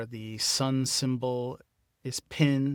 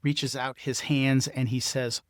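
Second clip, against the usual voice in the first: ordinary delivery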